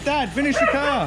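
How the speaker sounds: high-pitched voice for a pet; voicing the dog.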